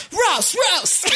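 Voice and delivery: chanting "raus" at full voice; raus shouted. Retold or imitated. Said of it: "we're chanting in there".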